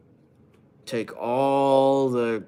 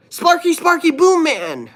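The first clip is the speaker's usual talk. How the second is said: high, loud cartoon-kid shout; Sokka impression; 6 dB louder than usual. Streamer doing a Sokka impression for chat, high loud shout. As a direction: yell it like the goofy Avatar kid. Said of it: "Let me do a Sokka impression".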